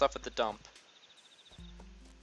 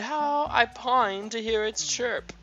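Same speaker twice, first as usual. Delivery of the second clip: catch announcement voice; 10 semitones higher, slower than usual. Pretend. Mimicking the game's catch line.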